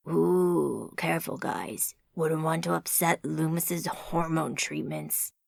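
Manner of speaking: low, mock-careful American tease; deep voice teasing the British man. A narrator's reading. Joker teases the British friend, low American voice. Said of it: "said the first voice again".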